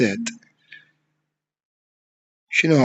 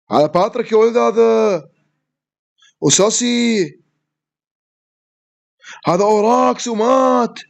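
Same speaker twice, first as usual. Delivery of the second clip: hyped; 6 semitones higher, slower than usual. Real, not acted. Hyped naming of opponents.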